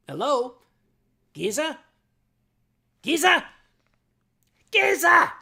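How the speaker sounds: put-on calling for the goat boy over the party, building to a yell; yelling a name. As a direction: shout the name down the phone. Calling for Giza on phone.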